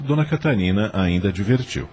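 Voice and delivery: usual speaking voice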